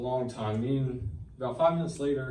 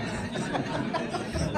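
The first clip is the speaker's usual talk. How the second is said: airy, high burst of laughter; breathless laugh. Real, not acted. Laughing as he recalls how quickly God answered. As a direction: laugh in disbelief.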